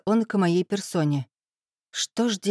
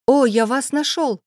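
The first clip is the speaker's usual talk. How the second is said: bright excited exclamation, higher and louder; exclaiming; 5 semitones higher, 5 dB louder than usual. A narrator's reading. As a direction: burst out with relief. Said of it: "воскликнул он".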